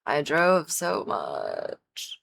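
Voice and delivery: dragging, tired words; slow and weary. Real, not acted. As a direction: draw it out, worn out. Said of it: "Today was exhausting".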